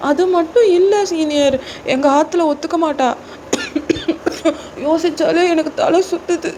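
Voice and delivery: put-on high-pitched, pleading girl character; distressed junior girl. Junior girl begging senior to let her go, distressed pleading. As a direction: beg desperately in a high voice.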